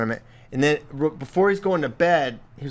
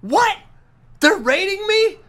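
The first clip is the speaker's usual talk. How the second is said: put-on high, loud, squealing outrage of a young kid; kid's outraged squeal; 4 dB louder, slower than usual. Son reads his rating, squeals in outrage. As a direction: play the indignant kid, pitched way up. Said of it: "He goes".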